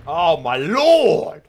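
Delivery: loud, high, slow exclamation; aghast shout. Real, not acted. Player aghast at the game, long loud cry. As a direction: bellow it, stretching out "lord".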